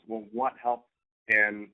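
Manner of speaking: ordinary delivery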